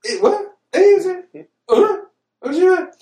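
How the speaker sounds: high-pitched, sputtering questions; hammed-up bewilderment; baffled disbelief. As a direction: squeak out broken questions as if utterly lost. Baffled by the film's logic.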